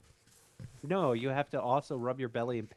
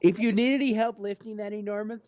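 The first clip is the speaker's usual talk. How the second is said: mock nurse; 10 semitones higher, 3 dB louder than usual. Friend playing the nurse teasing the couple. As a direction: tease like a sweet nurse.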